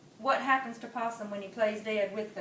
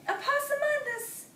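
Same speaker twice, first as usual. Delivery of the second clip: high, exasperated mama. Acted. Mama exasperated with her son. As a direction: sigh his name in disbelief.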